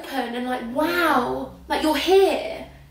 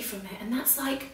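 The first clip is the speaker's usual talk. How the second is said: breathless whispered what; real hushed amazement; awed whisper; 3 semitones lower, 14 dB quieter, slower than usual. Real, not acted. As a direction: whisper it in disbelief. Awed by comedy from cancer.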